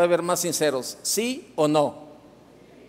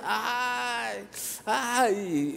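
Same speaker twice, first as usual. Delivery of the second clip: mock cries of pain; 5 semitones higher, 4 dB quieter than usual. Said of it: "entró al hospital con una gritería".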